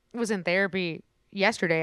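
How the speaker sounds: ordinary talk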